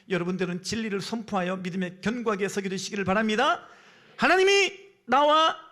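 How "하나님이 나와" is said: loud, high, drawn-out proclamation. Real, not acted. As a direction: hold each word high and loud. Preacher repeats the proclamation. (길게 끌며 힘차게 외치기)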